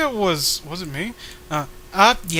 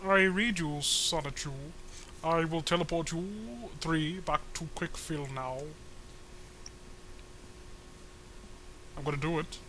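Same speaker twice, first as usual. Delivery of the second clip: flat, bored robotic delivery; monotone voice; 11 dB quieter than usual. Acted. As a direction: drone each word with no life. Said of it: "very boring monotone voice for this one".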